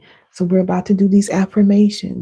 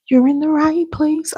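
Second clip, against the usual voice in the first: much higher, sung-out reassurance; emphatic high repeat; 9 semitones higher than usual. Real, not acted. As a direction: lift it up, say it again with feeling.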